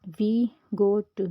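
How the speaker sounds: slow, loud, syllable by syllable; teacher demo of वी गो टू, each word drawn out; slow clear example words. Shown as a demonstration. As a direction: spell out वी गो टू slowly as single-syllable examples. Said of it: "ये हमारे single syllable है".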